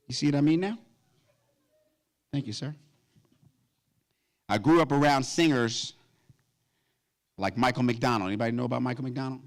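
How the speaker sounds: ordinary delivery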